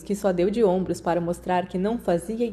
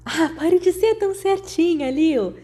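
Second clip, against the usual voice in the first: higher teasing voice; teasing friend; 9 semitones higher, 3 dB louder than usual. A narrator's reading. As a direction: tease your uptight friend. Marlene teasing Lily. (Marlene provocando a amiga, voz mais aguda)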